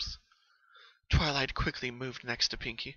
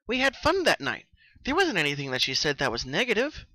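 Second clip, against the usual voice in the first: higher, louder bubbly pony voice; Pinky, subdued; 5 semitones higher, 7 dB louder than usual. Acted. Pinky telling Twilight when the dreams began. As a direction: explain it eagerly, a little worn.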